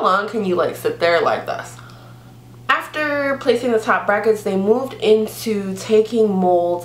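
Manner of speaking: lips stretched wide by fingers; talking with fingers in mouth; fingers pulling mouth open. Real, not acted. Seen 0: Fingers pull both mouth corners wide, showing braces, from 0.6s.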